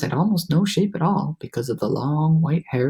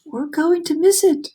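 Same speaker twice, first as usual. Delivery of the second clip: much higher, urgent shout; 12 semitones higher, 3 dB louder than usual. A narrator's reading. Ladybug yelling as the peach nears rainbow, shout. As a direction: yell it in alarm. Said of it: "shouted the ladybug".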